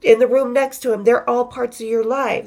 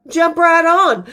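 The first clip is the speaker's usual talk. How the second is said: higher, punchy mock Southern invitation; playful Southern drawl, pitched up; 6 semitones higher, 4 dB louder, slower than usual. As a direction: mimic a Southern singer waving them aboard. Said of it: "with their southern draws, drawls".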